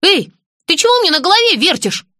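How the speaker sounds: indignant. Acted. Indignant protest at hairdo.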